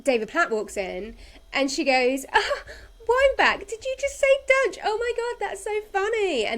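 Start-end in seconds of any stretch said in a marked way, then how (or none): 2.3-6.4 high, loud mock-flirty gasping delight; hammed-up gushing at a tiny joke; gushing flirt. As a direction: imitate someone grossly over-flirting. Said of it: "like, grossly flirting".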